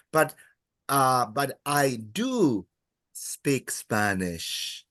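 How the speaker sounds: slow, with "do" weighted, fading on "speak Spanish"; emphatic do. Shown as a demonstration. Teacher giving the English emphasis, slow stress on "do". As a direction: lean on "do" and draw it out. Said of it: "that extra".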